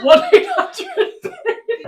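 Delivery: slow, laughing repeat. Real, not acted. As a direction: say it through laughter. Repeating phrase while laughing.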